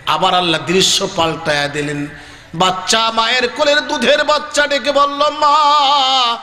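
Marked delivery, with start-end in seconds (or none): 2.5-6.4 high, long-drawn exclamations; impassioned preaching cry. Acted. Baby urges mother to look at paradise, high drawn-out preaching cry. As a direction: call out high, stretching each word.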